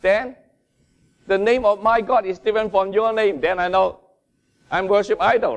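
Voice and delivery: high, loud, fervent preaching; preaching at full force. Acted. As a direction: preach it out with passion. Preaching with rising intensity.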